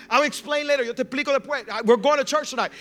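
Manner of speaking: loud, high phone insistence; insisting over pushback. Retold or imitated. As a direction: brush off the girlfriend's objections firmly. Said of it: "a little bit of pushback".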